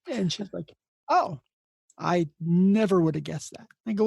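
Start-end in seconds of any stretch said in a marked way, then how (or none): 1.1-3.7 mock surprise. Chief nurse hears it is day two, voiced as loud surprise.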